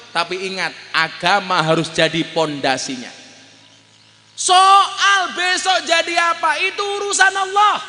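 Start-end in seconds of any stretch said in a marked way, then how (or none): 4.3-7.8 high, loud and slowly drawn out; fervent preaching rise; preaching climax. Real, not acted. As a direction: sing it out to the crowd.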